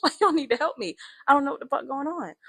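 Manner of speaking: high, loud exasperated demand; spazzing. Retold or imitated. Rescuer to onlookers who won't help, loud frantic spazzing. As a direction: insist on help, pitch and volume up. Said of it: "I start spazzing".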